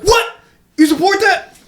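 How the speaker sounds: very high mock outrage; outraged disbelief. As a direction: squeak it out like a scandalized bystander. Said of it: "It's like".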